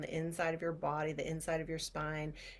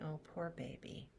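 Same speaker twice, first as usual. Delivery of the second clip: soft soothing; 9 dB quieter than usual. Shown as a demonstration. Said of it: "and you're saying".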